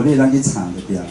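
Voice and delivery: ordinary delivery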